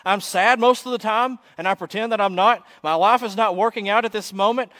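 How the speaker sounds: loud, high-pitched confession to the whole room; acted yelled outburst. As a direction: yell it out, fed up with pretending. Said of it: "I yell out to everyone that can hear".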